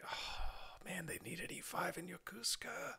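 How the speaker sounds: breathy whisper to himself. Retold or imitated. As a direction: whisper the secret wish. Inner thought whispered.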